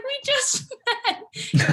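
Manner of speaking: high, slow, laughing "We just met"; retelling a stranger acting like an old friend, laughing and high; laughing disbelief. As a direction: laugh through it in amused disbelief. Retelling awkward food truck moment.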